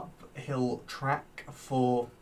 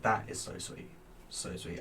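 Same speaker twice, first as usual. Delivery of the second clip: whispered reply; 3 semitones lower, 8 dB quieter than usual. Retold or imitated. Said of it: "and I was like".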